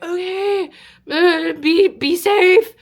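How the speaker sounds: high, hesitant send-off; worried parents. Retold or imitated. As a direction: imitate uneasy parents reluctantly letting go. Parents hesitantly agree to stay away.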